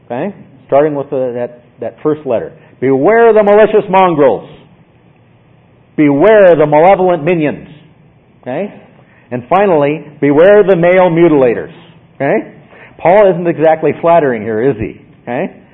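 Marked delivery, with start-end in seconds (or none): 2.8-7.7 loud, raised, thundering warning; forceful warning. Shown as a demonstration. Preacher voicing Paul's warning to the church, forceful and loud. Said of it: "how forcefully Paul is speaking". 10.2-11.7 loud, raised, thundering warning; forceful warning. Shown as a demonstration. Preacher voicing Paul's warning to the church, forceful and loud. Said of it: "how forcefully Paul is speaking".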